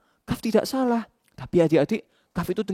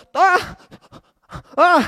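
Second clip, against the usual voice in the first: pained cry; 11 semitones higher, 6 dB louder than usual. Acted. Cry of pain.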